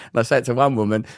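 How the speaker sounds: usual speaking voice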